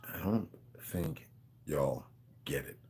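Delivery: very low, soft and slow, word by word; slow hushed emphasis. Real, not acted. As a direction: drop right down and space out every word. Repeats it slowly for emphasis.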